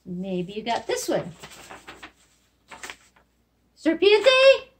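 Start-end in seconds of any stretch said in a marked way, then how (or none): 3.8-4.7 high, loud pronounced reveal; spring the answer on them; excited reveal. Shown as a demonstration. Teacher revealing the snake word to kids, high and loud. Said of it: "teacher models word after pause".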